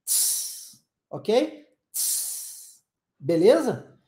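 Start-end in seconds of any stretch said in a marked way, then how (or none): 0.0-0.8 soft voiceless "tss"; tongue on teeth, let out a hiss; quiet cymbal-like hiss; English T hiss. Shown as a demonstration. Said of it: "cola a língua no dente". 1.9-2.8 soft voiceless "tss"; tongue on teeth, let out a hiss; quiet cymbal-like hiss; English T hiss. Shown as a demonstration. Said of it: "cola a língua no dente".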